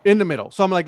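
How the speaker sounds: usual speaking voice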